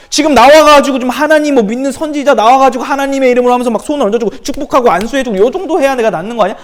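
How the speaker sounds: furious outburst. Acted. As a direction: blow up at the prophet's servant, then simmer down. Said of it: "좀 화내는 거예요". (폭발하듯 화내며 소리치다가 점점 누그러지는 장군)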